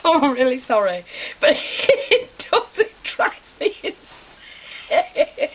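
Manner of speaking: high, slow words squeezed through real giggles; laughing apology. Real, not acted. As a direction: apologise while cracking up. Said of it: "I'm gonna have giggles now".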